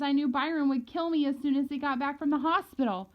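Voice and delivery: usual speaking voice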